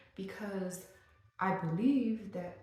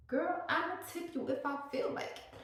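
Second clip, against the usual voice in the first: put-on much higher, sassy girl voice; sassy retort; 11 semitones higher than usual. Vlogger talking back to a tip screen, sassy high voice. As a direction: toss it off like a diva.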